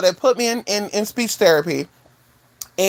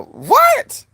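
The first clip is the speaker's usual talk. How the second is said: very high, rising squawk; mock disbelief; 4 dB louder than usual. Baffled by own botched word, high mock disbelief. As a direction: squeal in disbelief at yourself.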